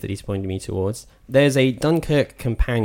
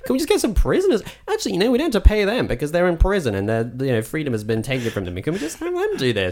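high-pitched mock chancer voice; mock innocent request, high and quick in the middle; imagined random person; 8 semitones higher, faster than usual. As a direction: pitch it up as someone cheekily asking for free labour. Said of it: "like, any random person. It's like".

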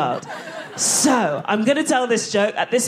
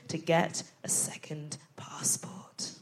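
hushed, secretive whisper; conspiratorial whisper; 3 semitones lower, 12 dB quieter, slower than usual. Shown as a demonstration. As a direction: whisper it like a confession. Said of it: "Ooh, I know".

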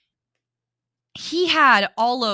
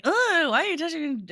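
put-on higher, fast, startled kid voice; startled Lucas; 7 semitones higher, faster than usual. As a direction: jolt awake and squeak it at your friend. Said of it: "Lucas is like".

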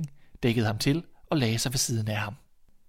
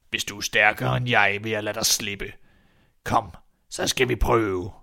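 weighty, drawn-out challenge; menacing old man; 3 dB louder than usual. Acted. Old man daring the boy to a contest, menacing. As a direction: issue the dare slowly and ominously.

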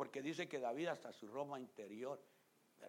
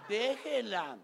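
laughing rebuke; 9 semitones higher, 13 dB louder, much slower than usual. Real, not acted.

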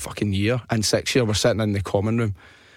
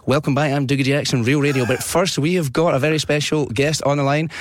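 lifted radio host patter, rising as it builds; radio presenter; 4 semitones higher than usual. Retold or imitated. Fake presenter welcoming Kenny on air, hammed-up DJ voice. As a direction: introduce a special guest like a radio DJ. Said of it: "pretended to be this presenter".

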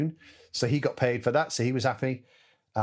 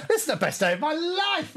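much higher, laughing with delight; gleeful laughing joy. Retold or imitated. Winking at the camera, laughing with high delight. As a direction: squeal it like the best day ever. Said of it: "And I was like".

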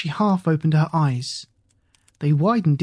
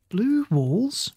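high, surprised question; a touch of startled surprise; 6 semitones higher than usual. Acted. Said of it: "she asked in surprise".

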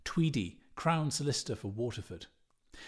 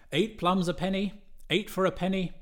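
street cry; 7 semitones higher, 5 dB louder than usual. A narrator's reading. Street hawker calling.

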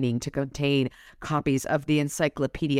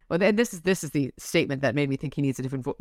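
ordinary talk, nothing marked

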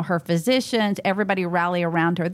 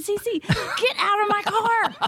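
high, drawn-out retold order; mock exasperation; 13 semitones higher, slower than usual. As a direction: order her out, slow and high, played for laughs. Acted exasperated order, amid laughter.

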